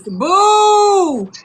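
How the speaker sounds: long, loud, high-pitched boo; jeering boo. Real, not acted. Host heckles caller over horrible advice, jeering boo.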